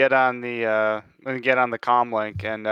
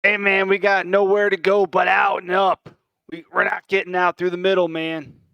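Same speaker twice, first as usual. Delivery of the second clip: shouted call across the room, higher; calling from far away; 7 semitones higher than usual. Acted. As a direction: shout over the gunfire to a teammate. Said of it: "I'm just gonna shout out".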